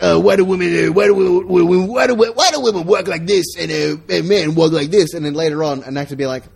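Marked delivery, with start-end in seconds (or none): 0.0-5.1 loud, higher, stammering comic delivery; hammed-up hack comedian, pitched up; mock stand-up comic. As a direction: stumble over the setup in a loud put-on voice. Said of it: "John will be like".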